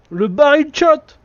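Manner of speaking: high, loud and slow; excited exclaim. Real, not acted. Gamer amazed a barrel got shot, excited high call.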